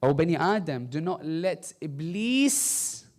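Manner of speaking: normal talking voice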